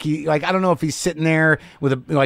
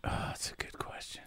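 whisper; 24 dB quieter than usual. Real, not acted. Hushed aside.

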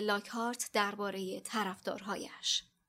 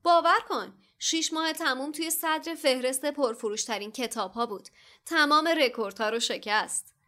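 boastful Lockhart; 4 semitones higher, 6 dB louder than usual. A narrator's reading.

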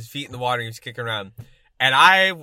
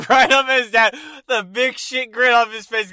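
normal talking voice

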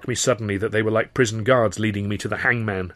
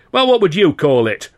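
loud, higher furious outburst; exploding; 8 semitones higher, 9 dB louder than usual. A narrator's reading. The governor to the young comic, exploding loud. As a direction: blow up at him. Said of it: "Carno exploded".

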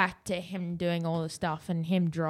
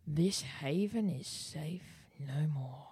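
whisper; 4 semitones lower, 9 dB quieter, slower than usual. Acted. End of an acted quote.

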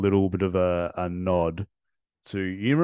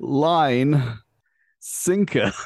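building excited delivery; 5 semitones higher, 4 dB louder, much slower than usual. Shown as a demonstration. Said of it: "I used to be able to do it".

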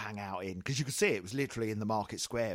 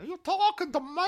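high squawking parrot imitation; 10 dB louder than usual. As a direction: screech it like a parrot. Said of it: "It sounded like a parrot".

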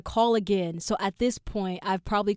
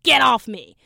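retold shout; 3 semitones higher, 10 dB louder than usual. Girl hits her with purse.

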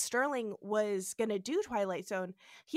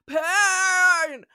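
loud, high, held mock cry; one big drawn-out theatrical yell; hammed-up shout; 8 semitones higher, 12 dB louder than usual. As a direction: belt it out melodramatically. Mock cry after episode title.

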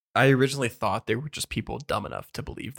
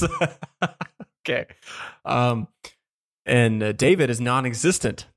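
ordinary talk, nothing marked

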